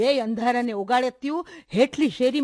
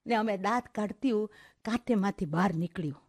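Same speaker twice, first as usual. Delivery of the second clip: ordinary delivery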